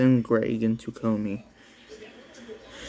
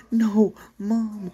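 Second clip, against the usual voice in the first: a high put-on child's voice; character voice; 10 semitones higher than usual. Psycho answering Mom in a high voice. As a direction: talk like an eerie little girl.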